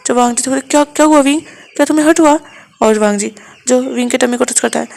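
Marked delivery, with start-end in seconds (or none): 0.6-2.4 anxious question, higher and louder; worried. Acted. Wang ji anxiously asks Wing if he is hurt, worried. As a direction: fuss over him in alarm.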